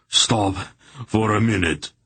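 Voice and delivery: gasping. A narrator's reading. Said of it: "Barak gasped".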